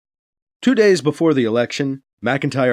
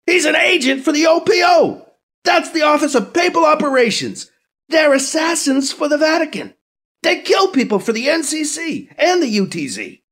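high, loud escalating rant; frantic shouting; 13 semitones higher, 4 dB louder than usual. Acted. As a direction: build the rant to fever pitch. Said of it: "McIntyre shouts".

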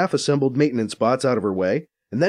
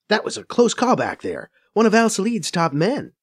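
brisk, higher female voice; young woman voice; 8 semitones higher than usual. Acted. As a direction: voice Faiza a bit higher. Faiza warns him.

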